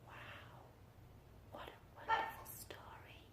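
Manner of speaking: hushed whispered praise. Acted. Teacher whispering to a nap-ready class. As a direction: keep the sleepy hush going. Whispered after finishing book.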